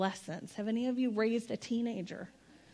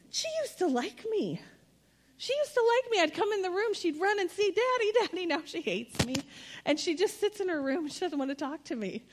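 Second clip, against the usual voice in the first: high, whiny impression of an upset father; mock-wounded parent, pitched well up; distraught dad; 8 semitones higher than usual. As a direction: lament in a hammed-up high voice. Imitates overheard upset father on plane.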